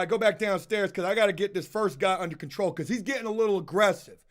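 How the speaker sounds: ordinary talk, nothing marked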